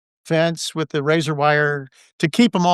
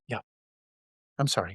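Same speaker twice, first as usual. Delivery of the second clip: soft airy apology; 5 semitones lower, 10 dB quieter, much faster than usual. Retold or imitated. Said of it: "light airy voice".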